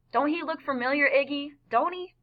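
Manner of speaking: high, insistent woman's voice; high-pitched prodding, climbing at the end; nagging woman. A narrator's reading. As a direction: pester him with rising questions. Woman nags Iggy about boy.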